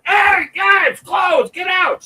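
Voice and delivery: high, loud, slow mock lifeguard shout; lifeguard yelling. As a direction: draw out the order to get out.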